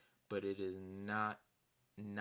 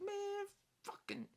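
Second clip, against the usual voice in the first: slow, much higher strained complaint; mock whine; slower than usual. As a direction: draw it out like a put-upon kid. His frustrated reaction.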